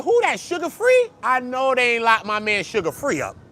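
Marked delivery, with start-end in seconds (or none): 0.0-1.1 surprised. Retold or imitated. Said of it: "I said".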